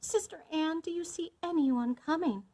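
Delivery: high, urgent call; crying out. A narrator's reading. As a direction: call desperately to the lookout. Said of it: "Once more she cried".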